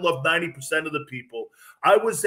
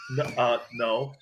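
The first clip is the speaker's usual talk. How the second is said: slow, low, hesitant no; sheepish admission; 4 semitones lower, 5 dB quieter than usual. Retold or imitated. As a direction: admit it haltingly, quieter and lower. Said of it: "I went like this".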